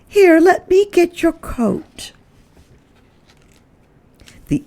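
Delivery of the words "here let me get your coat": high, louder helpful hostess; Mrs Prim offering. Acted. Mrs Prim offers Mrs Hipple her coat, high and eager. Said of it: "Missus Prim said".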